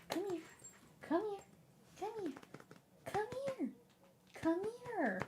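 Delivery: high, soft pet-calling voice; coaxing the dog. Real, not acted. Streamer coaxing her dog over to say hi, high and soft.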